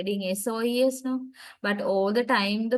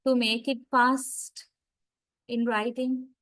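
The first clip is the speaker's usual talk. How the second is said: usual speaking voice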